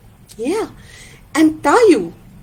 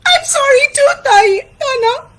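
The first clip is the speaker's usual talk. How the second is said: squeaky, very high crying voice; hammed-up high-pitched sobbing; Taín, tearful apology; 13 semitones higher than usual. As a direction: wail your apology back.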